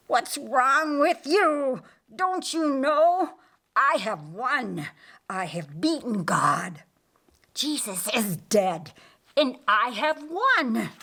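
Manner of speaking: high, frantic gloating; wounded Lucifer insisting he has won; defiant Lucifer. Acted.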